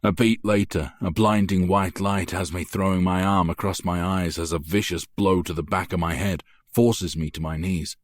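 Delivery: usual speaking voice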